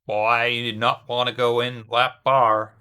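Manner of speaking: slow put-on Southern drawl; unhurried faux-Southern commentator; Southern accent. Shown as a demonstration. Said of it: "I could do it Southern".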